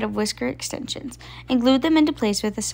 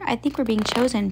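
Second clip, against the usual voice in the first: plain reading voice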